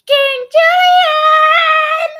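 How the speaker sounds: very high, loud, drawn-out squeal; Mort from Madagascar. Acted. Streamer imitating a cartoon character for viewers, squealing Mort voice. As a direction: shout it in a tiny high cartoon voice. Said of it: "as Mort from Madagascar".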